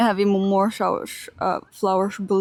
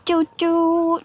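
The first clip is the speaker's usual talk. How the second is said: playful train noise; 7 semitones higher than usual. Retold or imitated. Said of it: "pretending to be a train".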